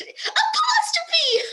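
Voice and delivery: long high wail of frustration; drawn-out exasperated shriek; exasperated cry. Real, not acted. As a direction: stretch it out in comic despair. Exasperated at endless apostrophes.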